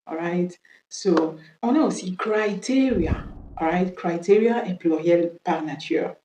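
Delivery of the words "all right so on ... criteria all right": ordinary delivery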